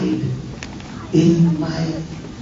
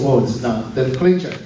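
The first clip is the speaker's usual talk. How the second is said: ordinary talk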